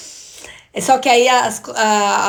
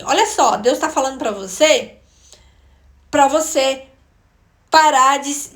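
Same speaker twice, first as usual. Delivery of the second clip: loud, raised voice of a stern message; man giving a word from God; 4 semitones higher, 6 dB louder than usual. Retold or imitated. Man rebuking her in front of the church, emphatic and raised. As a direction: imitate him delivering it, higher and louder, with pauses. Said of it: "E ele falou assim".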